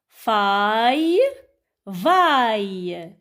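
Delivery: PAILLE, TAILLE, MAILLE each held long, mostly rising; chant-like list, stretching each "a-y" from PAILLE to VAILLE; syllable drill. Shown as a demonstration. Teacher models syllables for children to read, drawn out. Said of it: "se prononce aïe avec P".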